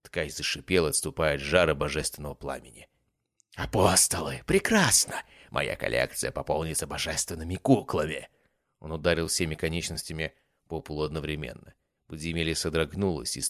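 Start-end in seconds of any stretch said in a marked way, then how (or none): 3.6-8.3 spiky, gleeful creature gloat; monster voice. Acted. The weaver gloating.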